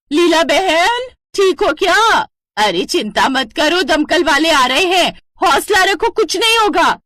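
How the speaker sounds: calling out. Acted. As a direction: shout reassurance into the smoke. Neighbour calling into smoky house.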